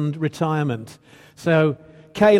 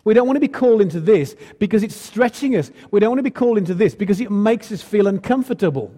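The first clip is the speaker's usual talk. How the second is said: higher, quicker voice of a people refusing; protesting crowd; 4 semitones higher, 4 dB louder, faster than usual. Retold or imitated. Crowd refusing a hard calling, protesting. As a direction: push back insistently, pitch up and pace quick. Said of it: "they were going".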